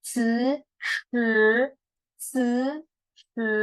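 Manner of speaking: hissy ci against chin-forward shi, each held level; contrasted ci and shi. Shown as a demonstration. Teacher comparing plain and H sounds, distinct ci and shi. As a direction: alternate a thin ci and a curled shi. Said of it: "不一样，beda ya".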